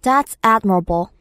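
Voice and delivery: slow, careful English read; slow demo read; slow chunk read. Shown as a demonstration. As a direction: read it slowly and clearly. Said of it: "끊어서 한번 가보죠".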